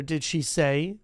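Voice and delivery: ordinary delivery, nothing marked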